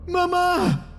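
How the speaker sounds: very high creature shriek; piercing little creature voice; screaming chest creature. A narrator's reading. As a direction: cry out high for mother. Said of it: "gritaban los cofres".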